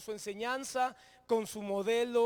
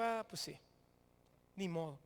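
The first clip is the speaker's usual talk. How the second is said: softer, lower, giving up; mock resignation; 5 semitones lower, 8 dB quieter than usual. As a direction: shrug it off quietly as the friends. Acts friends giving up on him.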